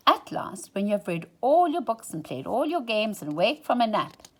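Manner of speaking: ordinary delivery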